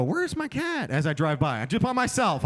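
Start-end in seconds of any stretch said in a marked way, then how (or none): 0.0-0.9 high mock question, lightly voiced; mock innocent call. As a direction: play dumb, voice up high. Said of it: "And I'll go".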